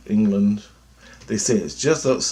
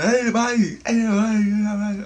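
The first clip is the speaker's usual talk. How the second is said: high, loud mock English country accent; 13 semitones higher, 4 dB louder than usual. Villagers greeting him in a high mock English country accent. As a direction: call out like a cheery English villager. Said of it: "people with such an accent".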